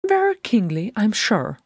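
high, dry little jibe; Bilbo, conversational. A narrator's reading. Bilbo needling the king over the mess, high casual sarcasm. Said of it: "said Bilbo conversationally".

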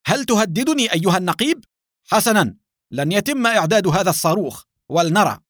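high-pitched, furious defiance; maddened shout. A narrator's reading. Base chief defying the captain's threat, shouting angrily. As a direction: cry out as if driven mad by the argument. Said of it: "كاد الدكتور سامي يجن من هذا الحوار فصاح".

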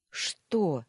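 slightly sharp question; sharp reply. A narrator's reading. Wife answers the maid's call, a little sharply. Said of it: "ответила резче, чем хотела".

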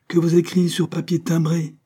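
high, gloating reply; triumphant. A narrator's reading. Madame Sainte-Juste scores off Landel, high triumphant gloat. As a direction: crow it over him. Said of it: "dit triomphalement madame Sainte-Juste".

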